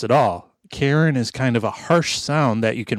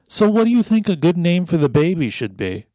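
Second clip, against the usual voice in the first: high, sing-song wife voice; mock wife asking; 6 semitones higher than usual. Hard Hat's wife asking about baby names, high sing-song. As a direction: ask sweetly like the wife. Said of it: "She's like".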